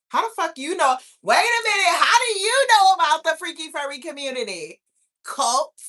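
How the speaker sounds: high, loud, incredulous challenge; playful shocked suspicion. Real, not acted. Grilling a commenter about furry knowledge, playfully shocked suspicion. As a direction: pounce on them with loud, teasing disbelief.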